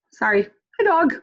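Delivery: normal talking voice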